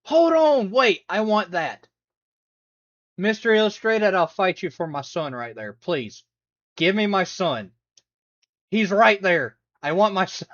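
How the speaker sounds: high, loud pleading demand. Real, not acted. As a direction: shout like you need it. Demanding the comic book.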